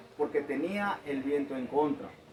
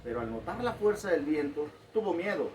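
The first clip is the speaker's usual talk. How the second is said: ordinary talk, nothing marked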